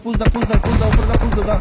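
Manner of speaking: loud urgent call to push; cover me shout. Real, not acted. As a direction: shout the teammate forward. Urging teammate to push in fight.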